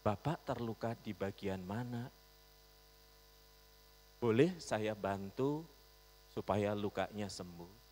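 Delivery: soft, unhurried kindness; calm and gentle. Retold or imitated. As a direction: soothe the angry rider. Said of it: "kata-katanya begitu calm, tenang". (lembut dan tenang)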